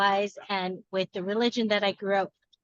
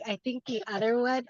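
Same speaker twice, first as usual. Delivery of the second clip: ordinary talk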